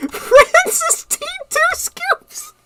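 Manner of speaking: high squealing laugh through words; corny dad laugh. Real, not acted. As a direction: crack up while reading the name. Laughing at own joke.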